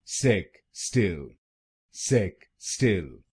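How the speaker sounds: सेक स्टिल spoken slowly in two parts, with a short "stil"; सेक स्टिल broken apart, ending in a short "i", not "tile"; very slow सेक स्टिल, syllable by syllable; split into syllables. Shown as a demonstration. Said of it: "दूसरे शब्दांश में फर्क है".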